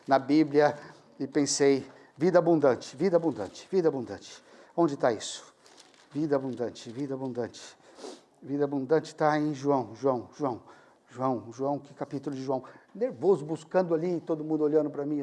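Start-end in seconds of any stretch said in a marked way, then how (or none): 2.2-12.6 baixinho e grave, procurando de memória; murmúrio pensativo. Retold or imitated. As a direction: repetir para si mesmo, como quem folheia a Bíblia. Pensando nervoso, folheando a Bíblia. (murmurando consigo mesmo, tentando lembrar)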